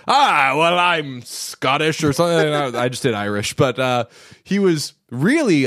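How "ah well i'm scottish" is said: lightly mocking thick Scottish accent, slow; Cary Elwes's Scottish accent. Podcaster mimics actor's overdone Scottish accent, slow mock Scottish. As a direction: ham up a fake Scottish accent. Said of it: "you wanna talk about laying it on thick".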